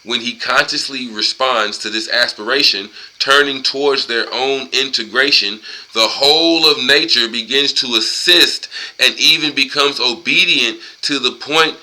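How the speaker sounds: emphatic, measured read-aloud; book reading. Acted. Man reading a book passage to listeners, emphatic read-aloud. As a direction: read it out firmly, word by word.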